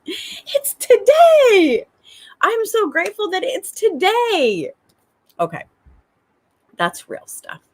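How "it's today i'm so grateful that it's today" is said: very high, drawn-out joyful squeal; childlike excitement. Acted. Mom matching her little girl's vibration, gleeful. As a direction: copy a toddler's glee at the new day. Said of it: "aligned with that vibration".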